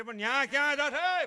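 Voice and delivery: high, very loud holler; loud call. Acted. Calling out to someone wandering off, loud shout. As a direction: shout it out as a challenge.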